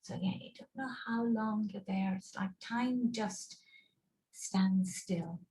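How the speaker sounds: ordinary talk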